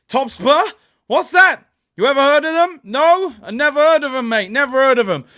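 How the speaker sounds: loud, high, taunting pretend bafflement; mock ignorance. Streamer teasing Tottenham as Wolves level, mock ignorance. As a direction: act as if you have never heard of the rivals.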